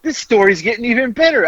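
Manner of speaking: high-pitched retold amusement; imitating amused laughter. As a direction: say it high, as if tickled by the story. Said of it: "And now I'm laughing".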